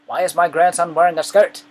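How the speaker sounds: grandfather's loud, high-pitched outrage; hammed-up indignant shout; imitating angry grandfather. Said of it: "be like".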